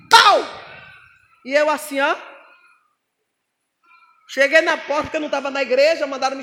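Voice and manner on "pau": loud punch. Retold or imitated. Golpe do diabo.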